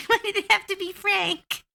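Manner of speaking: high squeaky mock wail over Frank; hammed-up goblin grief; high mock-grieving goblin voice; mock goblin lament.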